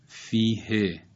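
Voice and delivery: فیه ending in a short e; short kasre. Shown as a demonstration. («فیه» با کسرهٔ کوتاه در آخر)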